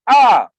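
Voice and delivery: loud, sudden demonic scream; possessed cry. Acted. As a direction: let out the unclean spirit's cry. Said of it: "o sea, gritaba". (grito fuerte de endemoniado)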